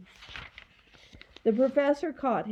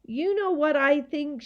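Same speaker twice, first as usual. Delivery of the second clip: put-on raised, indignant girl; shouted; 5 semitones higher, 4 dB louder than usual. A narrator's reading. Girl stepping forward to the gaboons, shouting. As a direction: speak up higher, fed up. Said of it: "she shouted".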